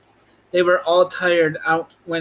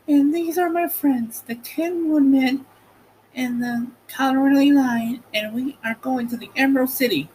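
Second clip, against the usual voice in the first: put-on high girlish voice introducing her friends; bright young girl, much higher; Dorothy's voice; 10 semitones higher than usual. A narrator's reading. Dorothy introducing her friends to the stork, high girl voice.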